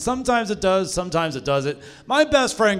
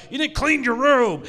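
mock scolding dad, higher pitched; berating dad; 6 semitones higher than usual. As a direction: lightly imitate a dad telling off his kid. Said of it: "getting berated by his dad".

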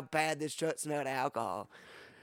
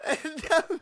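high laugh; 11 semitones higher than usual. Real, not acted. Laughs at own mistake.

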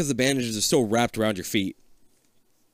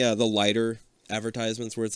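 ordinary talk

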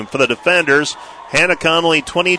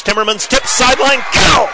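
excited call; 8 semitones higher than usual. Real, not acted. Live point, crowd moment.

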